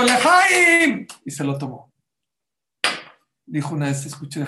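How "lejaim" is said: loud, high-pitched toast cry; exclaimed toast. Retold or imitated. Man who swore off whisky gives in, loud jubilant toast. Storyteller acts out the drinker's toast.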